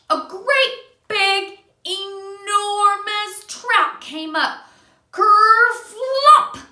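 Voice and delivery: slow, high and loud, stretched out; storyteller hamming up the monster fish; dramatic build-up. A narrator's reading. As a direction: draw out every word for the big reveal. Dramatic climax read to child.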